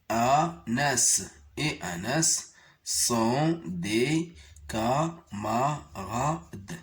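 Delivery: mot à mot, très lentement; lecture très lente. Shown as a demonstration. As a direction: lis chaque mot en le détachant. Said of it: "On va essayer de les lire". (lecture syllabée très lente)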